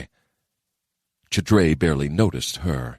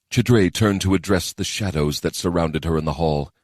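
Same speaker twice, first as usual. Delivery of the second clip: ordinary delivery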